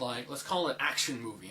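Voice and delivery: ordinary delivery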